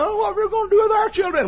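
high squeaky mock parent whine; mock fretting parent. Preacher mocking worried parents, squeaky.

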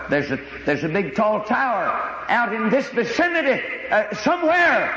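worried pilot not sure where the tower is; a hint of mock panic over the radio; panicky pilot. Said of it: "became alarmed and almost panicked".

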